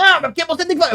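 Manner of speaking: high, loud mocking of the demanding caller; pushy caller. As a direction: squeak it out like the annoying caller. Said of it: "Ele falou".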